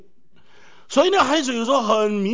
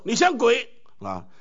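mock parent scolding; 3 semitones higher, slower than usual. Parent insult quoted.

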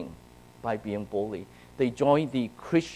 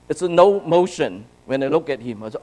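ordinary delivery

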